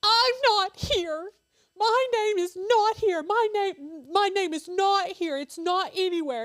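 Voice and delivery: put-on high, loud wail of a crying child; mother imitating her crying son at the cemetery; upset little boy. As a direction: whimper it like a heartbroken boy. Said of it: "He was like".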